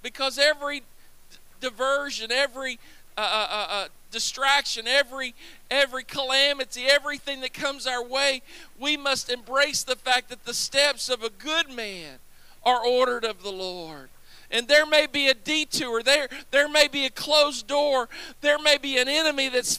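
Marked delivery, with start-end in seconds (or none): none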